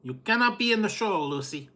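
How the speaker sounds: a hint of stern Ricky with an accent; mock scolding husband; Ricky impression. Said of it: "Lucy and Ricky quote".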